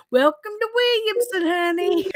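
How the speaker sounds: high-pitched imitation of a country papaw; Southern papaw. Somebody's papaw on the front porch, folksy Southern welcome. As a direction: greet them like grandpa from the front porch. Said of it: "sounds like somebody's papa's sitting out".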